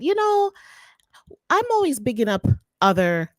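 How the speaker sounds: ordinary talk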